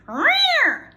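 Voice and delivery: high, loud snarling cat rear; angry cat. Shown as a demonstration. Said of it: "The Angry Cat says this".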